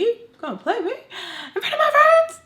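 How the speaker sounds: high, loud mock outrage with a laugh in it; hammed-up mad. Daughter getting all mad at mom, playfully overblown outrage. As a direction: blow up into a squealing mock protest. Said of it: "Getting all mad".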